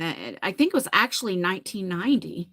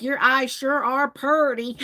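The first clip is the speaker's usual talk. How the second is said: imitated thick Southern drawl, slow; mimicking a man's strong Southern twang; strong Southern accent; 2 semitones higher, slower than usual. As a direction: slowly lay on a Southern accent. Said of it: "this is how strong the accent was".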